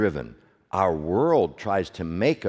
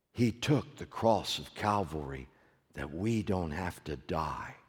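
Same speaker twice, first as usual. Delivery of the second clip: much softer, lower, reverent preaching; hushed reverence; 7 semitones lower, 9 dB quieter than usual. Acted. Pastor closing on the cross, hushed and reverent. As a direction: let it fall quiet and holy.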